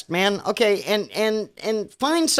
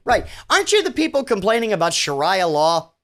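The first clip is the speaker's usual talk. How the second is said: ordinary talk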